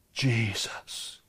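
a reverent whisper at the climax; whispered Jesus. Acted. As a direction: drop it to a breathy whisper on the name.

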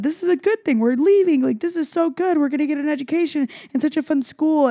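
sing-song denial of sadness; forced cheer. Retold or imitated. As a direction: talk yourself into being happy. Imitating own overbright forced optimism.

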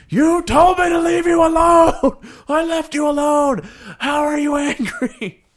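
loud high yell; exasperated yell. Retold or imitated. Yelling at his girlfriend who told him to leave, a loud high exasperated yell. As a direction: shout it at her in disbelief.

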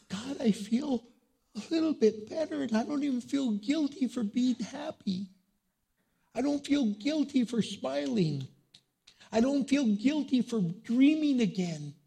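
high, loud, joyful prayer of a healed mourner; mourner's prayer. Pretend. Grieving believer thanking God after loss, high joyful prayer. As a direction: cry it out like someone freed from guilt. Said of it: "you could leave here today and say".

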